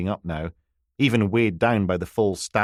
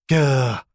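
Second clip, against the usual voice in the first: 1 stroppy groan; 5 semitones higher, 5 dB louder than usual. Acted. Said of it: "sounding every inch the stroppy teenager".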